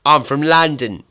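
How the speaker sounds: a hammed-up Cockney "I'm from London" that misses; failed Cockney accent. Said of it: "That was pish".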